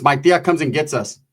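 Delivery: ordinary delivery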